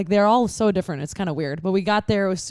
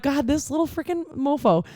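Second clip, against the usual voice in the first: exasperated; 7 semitones higher than usual. Retold or imitated. Exasperated mock insult.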